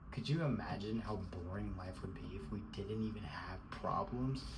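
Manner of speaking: whisper. Real, not acted. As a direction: drop to a confiding whisper. Sudden whisper mid-rant.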